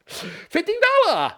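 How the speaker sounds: very high, slow mock Chinese accent; bad Chinese accent. Commentator replaying the wage demand for laughs, bad Chinese accent. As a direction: draw it out in a badly faked Chinese accent. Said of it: "Why am I doing a Chinese accent".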